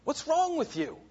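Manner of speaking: high, strained imitated rebuke. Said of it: "he rebukes them".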